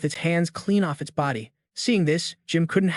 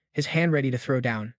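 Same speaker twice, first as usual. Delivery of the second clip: ordinary delivery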